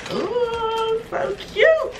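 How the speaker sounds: high, gushing coo; delighted coo. Real, not acted. Vlogger opening a cute compact mirror, cooing high. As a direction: coo it over something adorable.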